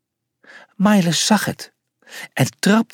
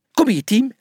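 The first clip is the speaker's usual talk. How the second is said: called out; 9 semitones higher than usual. A narrator's reading. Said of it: "riep hij".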